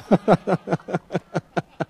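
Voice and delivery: a bright burst of laughter; real laugh. Real, not acted. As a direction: laugh at your own confession. Laughing at her own confession.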